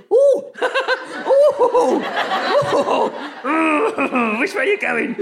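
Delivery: high, giggly oohs; flustered, laughing sidestep noises; awkward dodging. Retold or imitated. Comic telling of a pavement encounter with a stranger, awkward giggly dodging.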